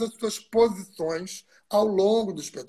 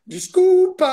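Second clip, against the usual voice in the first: "Desculpa" blurted out much higher and louder; mock loud retort; 12 semitones higher, 10 dB louder, slower than usual. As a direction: throw "Desculpa" back at him defiantly. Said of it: "Aí a Nádia virou e falou assim". ("Desculpa" gritado em tom de brincadeira)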